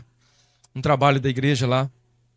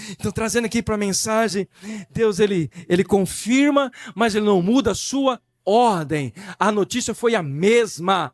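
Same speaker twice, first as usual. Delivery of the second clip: raised-pitch, emphatic sermon delivery; fervent preaching; 5 semitones higher than usual. Real, not acted. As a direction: drive the message home to the congregation, pitch held high. Preaches the message to the congregation. (pregação fervorosa e enfática)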